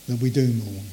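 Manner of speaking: ordinary delivery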